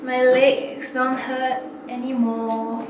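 normal talking voice

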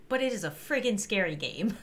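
ordinary talk

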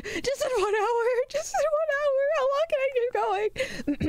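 very high, stretching out at the end; high-pitched reaction. Real, not acted. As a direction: pitch it way up, near a squeal. Reacting to one more hour.

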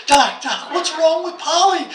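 anxious pet owner bursting in; frantic plea. Retold or imitated. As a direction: rush in begging for help. Owner rushes parrot to vet.

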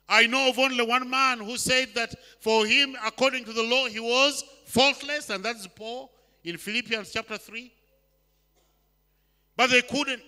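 loud, high, climbing preacher's cry; preaching at full force. Acted. Preacher denouncing the Pharisees' burden, loud and rising.

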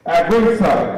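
ordinary talk, nothing marked

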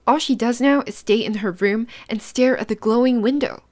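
usual speaking voice

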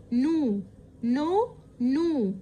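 "know" repeated, each starting on the N; silent K. Shown as a demonstration. Teacher drilling the word after explaining it, "know" with silent K. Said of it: "ال K silent".

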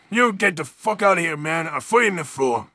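ordinary talk, nothing marked

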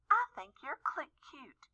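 soft, bashful whisper; shy whisper. Acted. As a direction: whisper it shyly. Shy doe flirting with Rudolph.

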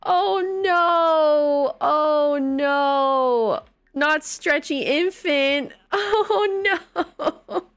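high, loud hammed-up cries of oh no; fake-sob over the screen, half laughing; mock wailing. Gamer mock-wailing at her stretched baby Sim.

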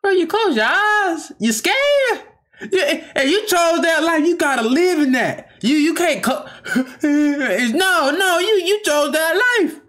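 high, amused teasing through laughter; laughing disbelief. Real, not acted. Reacting to a rapper who flinched, laughing and teasing. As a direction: laugh at him for closing his eyes.

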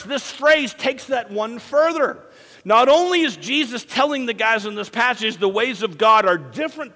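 high, loud, impassioned sermon delivery; forceful preaching. Real, not acted. Preacher urging church that God's ways are better, impassioned. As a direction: drive each point home, stretching key words.

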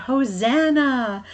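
storyteller's restrained shout of praise; mock crowd shout. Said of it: "waved palm branches and shouted".

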